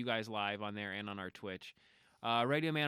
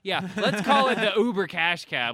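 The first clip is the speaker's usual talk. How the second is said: loud, slow grand pitch; excited announcement; 7 semitones higher, 11 dB louder, slower than usual. Acted. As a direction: announce it like a big reveal. Joking announcement of show name.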